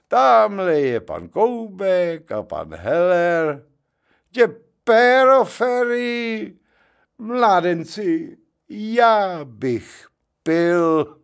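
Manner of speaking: put-on drawn out like a happy drunk; tipsy merry drunk. Tipsy Janík hails the gentlemen, merrily drawn out.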